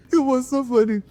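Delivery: very high, squeezed out through laughter; laughing. Real, not acted. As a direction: barely get it out for laughing. Cracking up over remembered scene.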